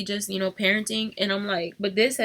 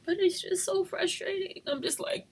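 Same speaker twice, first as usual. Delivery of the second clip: whisper; 6 semitones higher, 7 dB quieter than usual. Real, not acted. Upset mother venting quietly.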